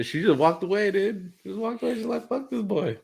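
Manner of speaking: high-pitched disbelief at being dumped; incredulous retelling. Retold or imitated. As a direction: tell it in a high, squeezed pitch. Laughing retelling of being rejected.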